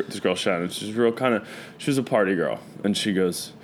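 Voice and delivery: ordinary talk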